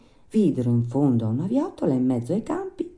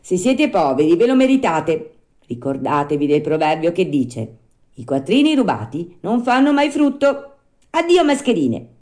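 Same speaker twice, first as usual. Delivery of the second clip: raised, loud puppet voice; scornful rebuff; 4 semitones higher, 6 dB louder than usual. Acted. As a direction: lecture the swindlers and send them off. Puppet rebuffs begging swindlers.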